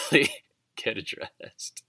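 laughing through the words, then a breathy fade; a nervous chuckle breaking in. Real, not acted. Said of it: "Like you're doing right now?".

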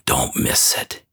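whispered plea. Retold or imitated. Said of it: "gentle but firm invitation".

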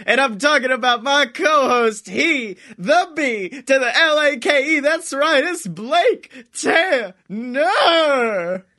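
big ringside-style introduction, key words stretched; hype announcer. Acted. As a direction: announce the show like a grand ring emcee. Hypes up the podcast intro.

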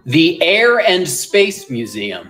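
Philly "The Air and Space Museum" with "Air" drawn out long; slow South Jersey "Air and Space Museum", "Air" stretched; Philly accent. Shown as a demonstration. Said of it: "they kinda all talk like this".

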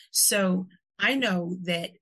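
ordinary talk, nothing marked

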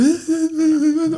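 high-pitched mocking giggle; mock laugh of the driver. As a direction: laugh like the careless driver did. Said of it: "und sie so". (spöttisch hohes Kichern der Fahrerin)

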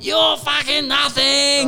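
high, slow mock holler; drunken yelling. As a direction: imitate a distant drunk bellowing from a boat. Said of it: "yelling their heads off".

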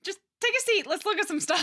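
high, loud flustered squeak; embarrassed high-pitched backpedal. Retold or imitated. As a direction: take it back fast in a panicked squeak. Boy backpedals quickly after joke.

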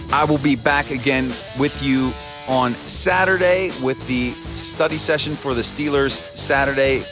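usual speaking voice

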